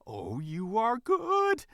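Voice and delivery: high, loud, slow and thrilled; delighted awe. A narrator's reading. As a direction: gush with admiration. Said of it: "regarded the Lady Fox in awe".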